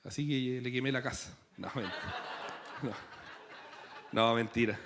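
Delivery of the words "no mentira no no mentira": low, soft, chuckling take-back; laughing retraction. Real, not acted. Preacher taking back his arson joke, laughing. Joke about burning the house.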